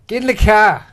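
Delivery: put-on loud gruff Batman with a Boston accent. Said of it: "Batman being voiced by, like, a Boston accent".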